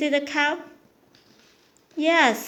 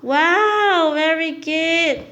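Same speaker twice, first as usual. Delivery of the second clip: excited praise; 4 semitones higher, 4 dB louder, much slower than usual. Real, not acted. Praising toddler's answer.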